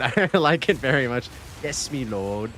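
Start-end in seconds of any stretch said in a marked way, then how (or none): 1.6-2.5 soft, lower mock British accent; playing a humble British servant. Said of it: "I changed the T T S to British".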